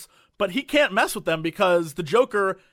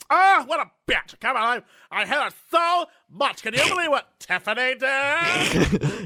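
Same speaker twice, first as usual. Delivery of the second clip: much higher, louder mock rant; loud gossiping girl; 7 semitones higher, 5 dB louder than usual. Mocking loud people gossiping about Tiffany, high and loud. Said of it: "they act like crazy people".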